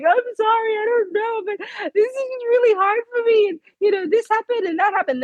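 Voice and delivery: high, tear-choked pleading; crying too hard to get the words out; sobbing apology. Real, not acted. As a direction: apologize through sobs. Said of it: "trying to get through all the sobs".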